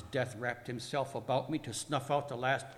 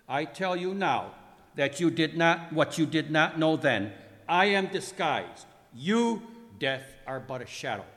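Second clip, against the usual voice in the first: loud, raised declaration to an enemy; emphatic theatrical rebuke, heavy on key words; forceful confrontation; 3 semitones higher, 8 dB louder than usual. Acted. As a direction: declare your authority over Death. Jesus confronts Death in dramatic reading.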